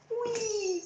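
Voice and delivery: high, breathy whispered whee; whispered gleeful swing. Shown as a demonstration. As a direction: whisper the squeal. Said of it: "whispered swing".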